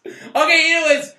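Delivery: loud, high announcer hype; announcer shout. Acted. Hosts teasing the next review, loud announcer shout. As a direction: shout it like a TV teaser.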